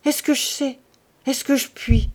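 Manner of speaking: high, helpless exclamation; anguished cry. A narrator's reading. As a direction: throw the questions out in despair. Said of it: "s'écria Lazare".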